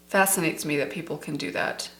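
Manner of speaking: normal talking voice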